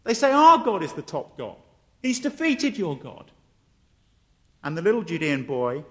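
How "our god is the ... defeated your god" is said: lightly acted playground boast; triumphant jeer in a retold argument; mocking kids. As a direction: crow over him like a taunting child. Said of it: "they laugh at him".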